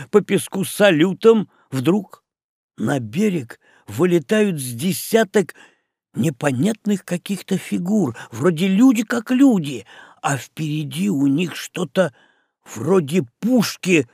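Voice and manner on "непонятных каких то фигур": plain reading voice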